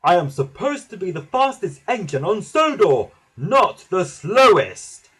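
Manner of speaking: loud, high outraged complaint; indignant huff. A narrator's reading. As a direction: huff loudly, dragging out the last words. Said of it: "he huffed".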